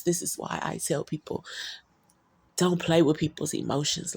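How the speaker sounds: usual speaking voice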